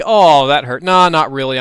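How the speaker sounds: loud fake-hurt taunt; mocking. Zombie making fun of pot thrower, loud mocking. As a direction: jeer loudly, pretending to be hurt. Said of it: "still making fun of me".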